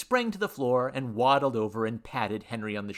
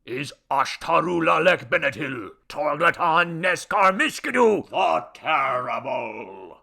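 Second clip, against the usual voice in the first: booming, slow proclamation of a grand title; raised, pompous ceremonial shout; formal; 4 semitones higher, 9 dB louder than usual. A narrator's reading. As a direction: declaim it loudly like a herald, stretching the end. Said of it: "in a formal tone".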